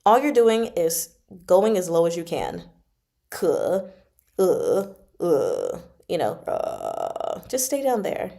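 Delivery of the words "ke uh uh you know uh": vocal fry. Shown as a demonstration. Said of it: "which is like a vocal fry".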